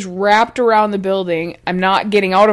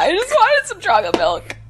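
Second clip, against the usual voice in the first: put-on whiny kid voice; 8 semitones higher than usual.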